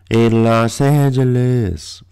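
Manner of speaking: slow, stretched put-on voice; mock drawn-out echo. Telling listeners what they won't see in L A, mock-drawling. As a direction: draw out every syllable of the city's name.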